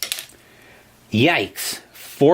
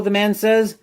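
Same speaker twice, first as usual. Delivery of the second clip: usual speaking voice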